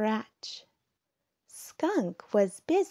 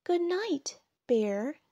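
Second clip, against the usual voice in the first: little ladybug; 8 semitones higher than usual. A narrator's reading. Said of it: "said Ladybug".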